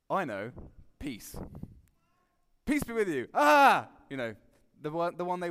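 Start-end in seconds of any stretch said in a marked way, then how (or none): 1.0-3.3 Jesus blurting out "Peace" abruptly, then louder; a flustered interjection; awkward blurted greeting. Acted. Jesus interrupting the talking disciples with an awkward, abrupt greeting. 3.3-3.9 high loud yelp of fright; startled shriek. Acted. Frightened disciples shrieking when Jesus suddenly appears.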